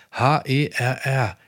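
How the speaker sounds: H E R R spelled letter by letter, slowly; spelling it out. Shown as a demonstration. Preacher showing the capital letters, spelled slowly. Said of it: "in großen Buchstaben geschrieben".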